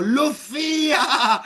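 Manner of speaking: high, loud, slow drawn-out name; long echoing call; drawn-out shout. Pretend. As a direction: stretch the name out in a calling yell. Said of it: "sino que gritó".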